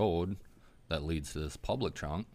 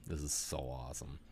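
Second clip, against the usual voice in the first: hushed thrill; 2 semitones lower, 8 dB quieter than usual. Retold or imitated. Hushed hunting excitement.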